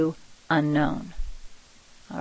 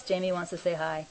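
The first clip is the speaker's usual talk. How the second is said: usual speaking voice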